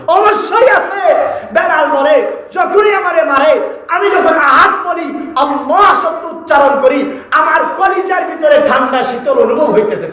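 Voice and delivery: high, drawn-out sermon declamation; impassioned preaching. Real, not acted. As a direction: stretch the phrases in a high preaching cry. Preaches at full force. (উঁচু সুরে টেনে টেনে ওয়াজ)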